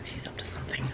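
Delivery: conspiratorial whisper. Real, not acted. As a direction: hush it like a secret. Aside about the dog.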